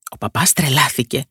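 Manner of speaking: usual speaking voice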